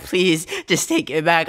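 high, strained plea; desperate plea in performance; pleading. Acted. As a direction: beg for it, voice tight. Pleading line in poem.

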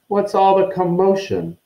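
high-pitched, officious question; Percy Weasley. A narrator's reading. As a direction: demand an explanation from the crowd. Percy arrives, questioning the crowd.

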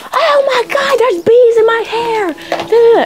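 very high squealing panic about bees; mock panicked scream. Friend flipping out over bees in her hair, high mock shriek. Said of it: "She started screaming".

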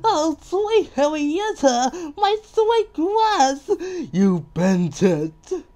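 high, slow, hammed-up wailing; anguished cry. Mister Fussy mourns his bent grass, anguished high cry. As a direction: wail loudly over a precious treasure. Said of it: "cried Mister Fuzzy".

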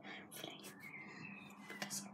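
hushed whisper from a hiding spot; whispering while hiding. Real, not acted. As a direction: whisper so the seeker can't hear. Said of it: "Don't tell".

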